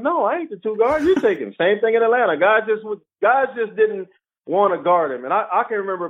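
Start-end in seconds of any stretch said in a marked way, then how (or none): 0.0-1.5 higher, lively retold refusal; mock protest. Player refusing to take the guarding job, mock protest. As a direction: push it back in a raised voice.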